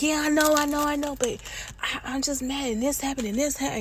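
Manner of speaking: high-pitched flustered excuses; upset protest. Retold or imitated. Upset woman answering her partner, high and flustered. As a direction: pitch way up, defending herself.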